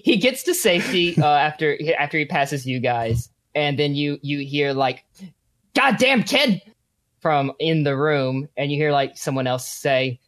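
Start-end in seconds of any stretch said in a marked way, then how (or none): 5.8-6.6 higher, louder curse; angry shout. A narrator's reading. Hidden man yells after escaping boy, angry. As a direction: yell at the kid.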